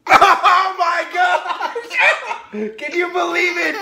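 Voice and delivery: high, loud laughing squeal; hysterical excitement. Real, not acted. Parent reacting to a coupon deal, squealing with laughter. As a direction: shriek it slowly through big laughter.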